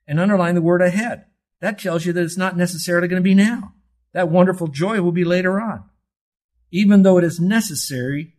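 fervent sermon climax; preaching at full force. Real, not acted. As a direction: drive each phrase home to the church.

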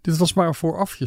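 ordinary delivery, nothing marked

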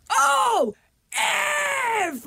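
very high, drawn-out mock scream; retold scream. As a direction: re-enact screaming in pain, without full volume. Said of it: "I just start screaming".

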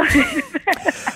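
sudden high burst of laughter; a real high laugh at her own quip. Real, not acted.

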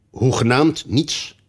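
insisting king. A narrator's reading. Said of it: "drong de koning aan".

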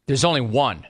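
usual speaking voice

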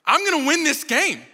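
put-on high, loud burst of kid confidence; excited ten-year-old. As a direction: blurt it like a kid sure of winning. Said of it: "at ten years old, was so excited".

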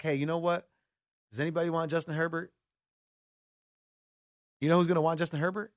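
normal talking voice

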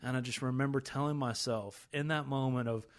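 usual speaking voice

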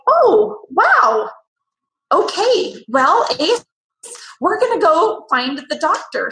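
imitating a therapist caught off guard; a high alarmed voice that settles into reassurance; alarmed surprise.

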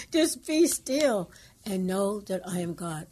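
loud and slow, each word laid down; firm, slow proclamation. Retold or imitated. Caller repeating God's command, loud and slow. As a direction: proclaim it with weight.

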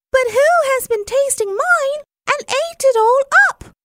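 tiny squeaky-high little bear voice; very high, quick, upset little cub; Baby Bear. A narrator's reading. Poor little bear finds his soup gone, very high cry. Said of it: "cried the poor little baby bear".

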